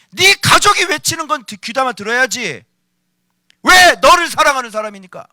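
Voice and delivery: 높고 크게 몰아치는 꾸짖음; 믿음 없음을 호되게 질책하기; 격렬한 설교. Acted. 격하게 설교함. (불같이 꾸짖는 설교조)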